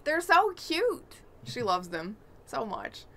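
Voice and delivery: normal talking voice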